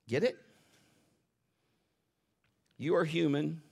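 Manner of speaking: usual speaking voice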